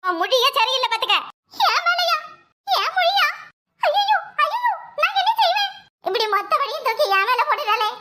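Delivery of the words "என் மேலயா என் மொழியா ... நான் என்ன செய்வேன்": high-pitched wail of innocence; theatrical, much higher whine; wounded protest. Acted. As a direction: squeal it in hurt disbelief. Accused, protests in wounded alarm.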